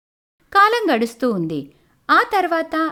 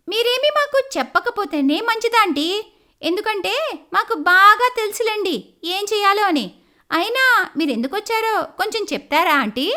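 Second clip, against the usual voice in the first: put-on higher, pointed young woman's voice; sharp young lawyer; 6 semitones higher than usual. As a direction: put the nosy aunt in her place. Neha dismisses the neighbour.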